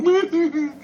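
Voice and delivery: very high, wordless mocking whimper; mock sad tune. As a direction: squeak out a tiny fake lament. Mock sad music for complainers.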